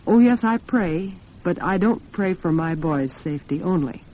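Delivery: ordinary delivery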